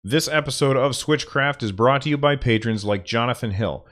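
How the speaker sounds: upbeat podcast ad read; sponsor read. Acted. Podcaster reading the Audible sponsor spot, upbeat. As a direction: sell it like a host reading an ad. Reads patron sponsor message.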